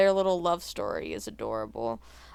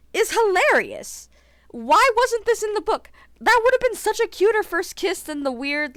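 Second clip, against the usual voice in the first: higher and louder, bubbling over with delight; excited gushing; 10 semitones higher, 5 dB louder than usual. Real, not acted. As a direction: squeal a little about how cute it is. Gushing over the book's first kiss.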